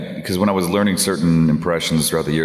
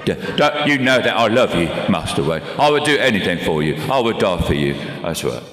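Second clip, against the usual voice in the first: devoted Cockney butler impression; earnest Cockney, from the back of the throat; Michael Caine. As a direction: pledge loyalty in a throaty Cockney voice. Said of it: "add that sort of little Cockney accent".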